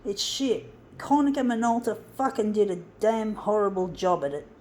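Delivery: loud, furious swearing rant; angry rant. Real, not acted. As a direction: vent loudly at the machine. Ranting at bad photocopier interface.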